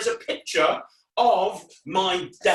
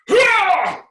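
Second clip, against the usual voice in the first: a big high loud cry; superhero battle cry; 12 dB louder than usual. Shown as a demonstration. Entertainer demonstrates the throwing sound effect, loud cry. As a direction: roar it like a superhero. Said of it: "do the sound effects as well".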